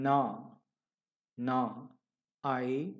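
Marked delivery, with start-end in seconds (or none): none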